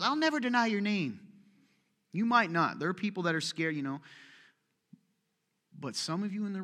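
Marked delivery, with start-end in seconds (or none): 0.0-1.2 mock bold vow, pitched higher; boastful vow. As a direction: puff up like an overconfident disciple. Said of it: "the one that goes".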